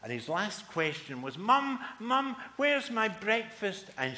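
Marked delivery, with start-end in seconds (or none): 1.4-3.9 put-on high, loud boy's call; hungry kid shouting as he rushes out; frantic child; calling mum. Boy shouting for mum again.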